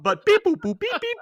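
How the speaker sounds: high, loud chirpy droid bleeps; droid beeping. Retold or imitated. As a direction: chirp the beeps like a little robot. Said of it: "makes the droid noises".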